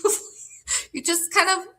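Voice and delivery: high giggling through the words; giggly and high, cracking up; laughing. Real, not acted. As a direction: laugh while you explain.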